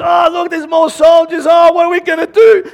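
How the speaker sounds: very high, shouted mock hysteria; acted panic. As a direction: build to a loud, frantic wail. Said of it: "is the fear and the panic".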